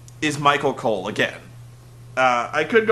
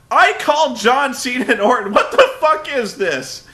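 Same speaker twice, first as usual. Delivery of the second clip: high, raised voice with a laugh; mock indignant Cole; 8 semitones higher, 5 dB louder than usual. Cole complaining about his assignment, higher mock indignation. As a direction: sound insulted to be stuck there. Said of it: "he really is like".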